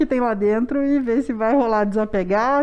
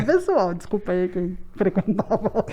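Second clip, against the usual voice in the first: ordinary talk, nothing marked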